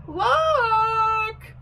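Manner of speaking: very high drawn-out screech. A narrator's reading. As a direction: screech the name out long. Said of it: "she screeched".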